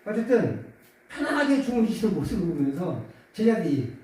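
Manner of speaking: usual speaking voice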